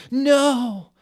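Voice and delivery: very high, loud drawn-out no; a huge, high wailing shout of no; bellowing. Acted. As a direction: bellow it in grief across the room. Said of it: "everyone bellowing".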